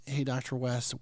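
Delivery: ordinary talk, nothing marked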